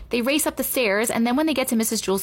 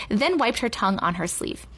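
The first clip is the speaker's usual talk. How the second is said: usual speaking voice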